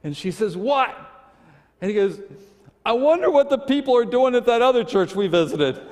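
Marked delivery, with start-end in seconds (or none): 0.6-0.9 high, raised-voice answer; short shouted question; called-back reply. Retold or imitated. Wife calls back over noise. 2.8-5.8 high, loud holler; raised voice, carrying to someone out front; yelling across the booth. Retold or imitated. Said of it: "he yells".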